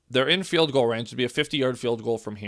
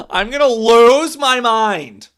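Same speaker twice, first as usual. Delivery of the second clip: loud, higher and slow yell of "lose my mind"; exasperated yell; 8 semitones higher, 10 dB louder, slower than usual. Real, not acted. Fan telling chat he is losing it, loud exasperated yell. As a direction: shout it like you're losing it.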